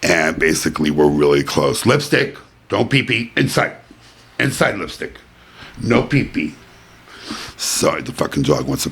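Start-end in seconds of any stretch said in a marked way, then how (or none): 1.9-6.6 higher, sharp commands to a pet; "pee pee" said high and firm, talking down to a dog; telling off the dog. Real, not acted. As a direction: scold the dog off the rug. Scolding the dog mid-podcast.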